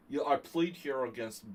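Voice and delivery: badly faked Georgian New York accent; shaky attempt at a Russian-New York accent impression; Roman Gingiashvili. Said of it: "I can't really do Roman".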